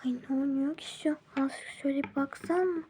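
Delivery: fast, nosy whisper; snooping on the neighbour's screen, hushed. Acted. Nosy neighbour peeks at phone. (hızlı, meraklı fısıltı)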